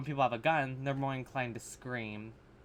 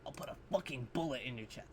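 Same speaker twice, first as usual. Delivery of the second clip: mocking tough guy; 8 dB quieter, faster than usual. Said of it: "Again with the cursing".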